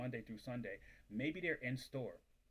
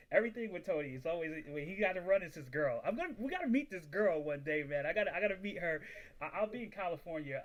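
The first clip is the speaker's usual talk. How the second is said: excited rant; 5 semitones higher, 7 dB louder than usual. Real, not acted. Hyped rant about Tony's girl.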